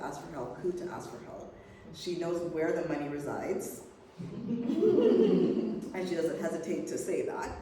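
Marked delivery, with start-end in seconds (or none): 3.7-5.9 high hearty laugh; loud laugh. Real, not acted. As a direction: laugh at own joke. Laughing about money joke.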